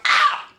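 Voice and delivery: sudden comic yelp of pain; mock pain cry. Mid-swing when back pain hits, mock pain cry. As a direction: cry out as if pain just struck. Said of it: "the pain comes out of nowhere".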